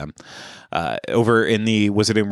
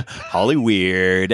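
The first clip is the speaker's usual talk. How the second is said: drawn-out joking voice; much slower than usual. Pretend. Joke drawn-out reply.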